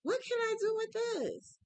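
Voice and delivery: high, bewildered question at a strange yarn; puzzled exclaim. Retold or imitated. Crafter recalling her first look at the yarn, baffled. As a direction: ask it as if stumped by the yarn. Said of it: "I said".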